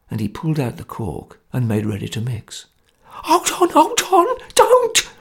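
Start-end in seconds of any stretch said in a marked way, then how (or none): 3.0-5.1 high, panicked pleading; terrified cry. A narrator's reading. Sotcher cries out in terror as the cork comes out, high and panicked. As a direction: cry out in terror to stop him. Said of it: "cried Sotcher in a sweat of terror".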